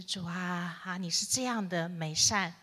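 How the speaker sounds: ordinary talk, nothing marked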